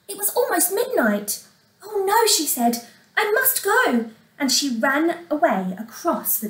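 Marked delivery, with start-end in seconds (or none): 1.8-2.3 high-pitched cry of dismay; alarmed. A narrator's reading. Cinderella sees it is almost midnight, alarmed. 3.2-4.1 high, anxious insistence; alarmed haste. A narrator's reading. Cinderella tells the prince she must leave, alarmed.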